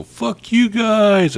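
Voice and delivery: high, drawn-out mocking shout; taunting voice. Game master voices an unseen heckler to the guards, taunting. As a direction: stretch it out loud and high, as a jeering heckler. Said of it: "you hear a voice go".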